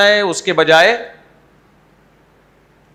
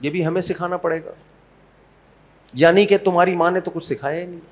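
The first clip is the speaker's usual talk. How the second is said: very soft mock-sweet mother-in-law taunt; quiet barb in a sugary tone; sweetly venomous; 7 dB quieter, faster than usual. Shown as a demonstration. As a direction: needle her gently, hushed and honeyed. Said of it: "صرف بڑے میٹھے لہجے میں بول لے".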